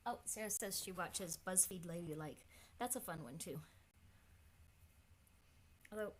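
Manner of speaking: ordinary delivery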